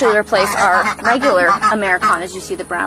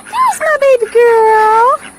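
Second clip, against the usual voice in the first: high slow cooing to a pet; 14 semitones higher, 7 dB louder, slower than usual. Real, not acted. Greeting the goose fondly.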